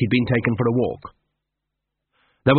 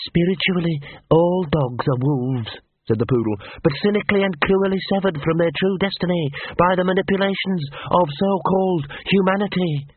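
fanatic poodle; 6 semitones higher than usual. A narrator's reading. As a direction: recite it like a memorised creed. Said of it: "It sounded like a quote".

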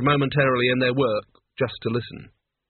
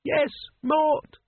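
distant call; slower than usual. Acted. Calling down from above.

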